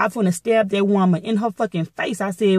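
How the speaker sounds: usual speaking voice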